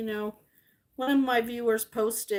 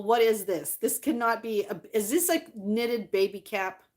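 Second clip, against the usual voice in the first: ordinary talk, nothing marked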